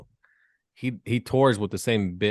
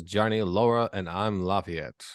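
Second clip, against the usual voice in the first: ordinary talk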